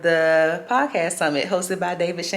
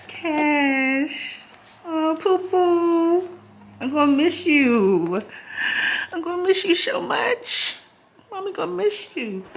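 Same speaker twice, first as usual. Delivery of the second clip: tearful goodbye; 11 semitones higher, slower than usual. Real, not acted. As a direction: coo a sad goodbye to your dog. Saying goodbye to her dog.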